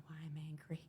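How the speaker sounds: whispered retold realization; quiet, breath-only disclosure; hushed confession. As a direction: lose the voice to a whisper. Retold phone confession to husband.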